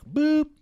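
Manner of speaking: high, loud beep noise mouthed; imitated machine beep. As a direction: chirp it like an answering machine.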